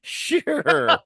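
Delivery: high, loud, drawn-out "sure"; mocking disbelief. Friend laughing at his marriage claim, high mocking "sure".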